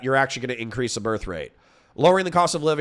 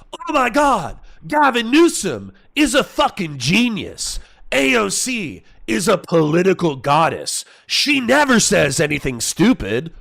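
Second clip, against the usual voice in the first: much higher and louder, gushing fake adoration; mock fawning praise; 5 semitones higher, 5 dB louder than usual. Pretending to grift by gushing over Democrats, in mock adoration. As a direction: ham up a starstruck sycophant, slow on Gavin Newsom. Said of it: "how easy it would be, like".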